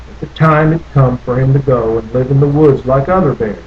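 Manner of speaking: ordinary delivery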